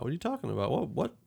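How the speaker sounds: ordinary delivery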